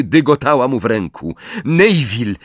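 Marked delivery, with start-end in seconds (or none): 1.6-2.3 high, urgent shout; urgent call. A narrator's reading. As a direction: call him back sharply. Harry calls out to Neville. (pilny, wysoki okrzyk Harry'ego)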